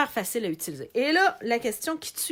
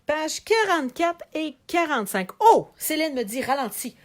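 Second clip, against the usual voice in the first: ordinary delivery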